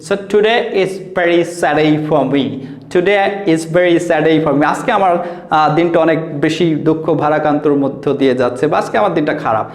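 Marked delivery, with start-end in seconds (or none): 0.1-4.6 slow demo. Shown as a demonstration. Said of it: "এরকম কিছু বলে".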